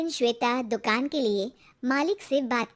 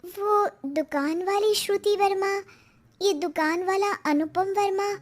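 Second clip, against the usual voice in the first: high-pitched wife piecing clues together; realising wife; 7 semitones higher than usual. Acted. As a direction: think aloud in a raised pitch as it dawns. Wife working out the truth.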